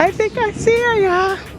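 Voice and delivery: excited. Real, not acted. Vlogger spotting her cousin's stall, excited high squeal. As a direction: squeal it as she comes into view.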